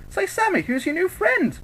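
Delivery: put-on very high, squeaky girlish chatter; perky teasing squeak; cheerful. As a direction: chirp the question way up high. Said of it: "she was cheerful".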